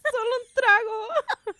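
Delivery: high, slow, whining plea; mock begging cry. Begging in the telenovela meme, high slow whine. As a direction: whimper for just one drink.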